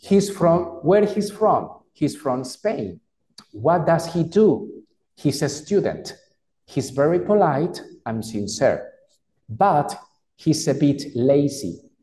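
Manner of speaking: slow, carefully pronounced English; slow model reading. Shown as a demonstration. Teacher continues reading the dialogue, slow and careful. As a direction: read the lines slowly for learners. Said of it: "Voy a volverlo a repetir".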